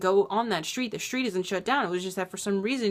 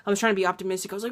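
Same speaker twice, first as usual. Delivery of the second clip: normal talking voice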